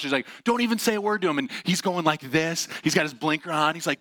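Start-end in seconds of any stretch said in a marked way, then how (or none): 0.4-1.4 warning wife. Retold or imitated. Wife warning him in retold story.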